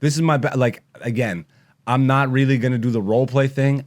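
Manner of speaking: ordinary talk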